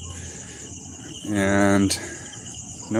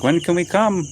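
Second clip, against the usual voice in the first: higher-pitched imitation with a lifted ending; eager visitors; 8 semitones higher than usual. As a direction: lightly mimic someone asking hopefully. Acts out Filipino Mormons asking to visit.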